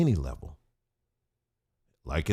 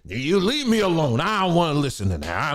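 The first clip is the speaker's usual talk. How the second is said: mock refusal; 5 semitones higher, 4 dB louder than usual.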